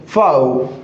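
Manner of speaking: फाव as a single falling "faav"; correct pronunciation. Shown as a demonstration. Teacher introducing a word to students, फाव as one low syllable. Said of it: "इसका correct pronunciation क्या हो जाएगा".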